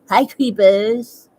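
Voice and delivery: teasing greeting. Real, not acted. Reader calling out lurkers in her live room. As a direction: tease the silent viewers. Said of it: "when I start calling people creepers".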